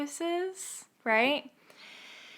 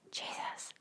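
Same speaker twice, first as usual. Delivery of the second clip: barely voiced, hushed whisper; 14 dB quieter than usual. Woman retelling forcing herself to say a prayer word, whispered. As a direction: force out one word in a faint whisper. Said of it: "I literally whisper".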